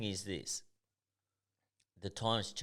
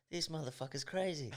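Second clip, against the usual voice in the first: mate's remark; 8 semitones higher, 3 dB quieter than usual. Retold or imitated. Retold mate's amazed remark.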